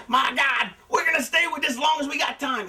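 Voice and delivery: excited, high-pitched wrestling commentator call; urgent ringside announcer, pitched well above normal talk; Jim Ross. Retold or imitated. Retelling commentary on a wrestling angle, excited announcer voice. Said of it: "Jim Ross keeps saying".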